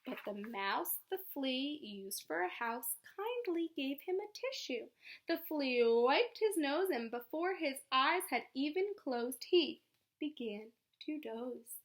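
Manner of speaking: lively read-aloud storytelling; story narration. A narrator's reading. Teacher reading a story to children, lively. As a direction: read it like a bedtime picture book.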